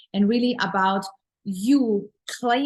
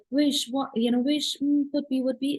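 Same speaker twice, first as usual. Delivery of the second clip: wishy-washy higher-pitched hemming; mock a hesitant, wishful dreamer; wishful lack; 4 semitones higher than usual. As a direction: waver in half-finished wishes, pitched up. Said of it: "it's, it's lack".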